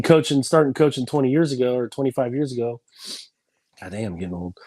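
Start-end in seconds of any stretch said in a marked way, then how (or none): 3.8-4.6 low, quiet self-mocking aside; rueful aside. Real, not acted. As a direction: grumble it to yourself, amused. Aside about age.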